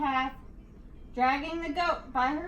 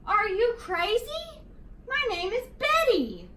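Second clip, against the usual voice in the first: indignant monster; 7 semitones higher than usual. A narrator's reading. Betty the monster offended at being called Yeti, indignant. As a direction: bristle with insulted outrage. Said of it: "with a furious gaze".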